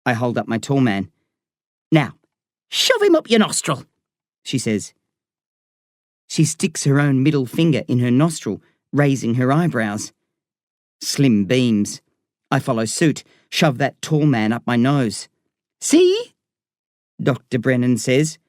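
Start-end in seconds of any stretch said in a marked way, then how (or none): 1.9-3.9 very high Irish doctor voice; Irish accent. A narrator's reading. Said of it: "I love her Irish accent". 15.8-16.3 high, pleased Irish doctor; Irish accent. A narrator's reading. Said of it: "I love her Irish accent".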